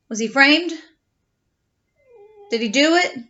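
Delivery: ordinary delivery, nothing marked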